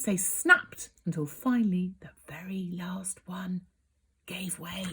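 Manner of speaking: hushed, drawn-in whisper; suspenseful whisper. A narrator's reading. Reading the scary moment to a child, whispering. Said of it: "dramatic whispered reading".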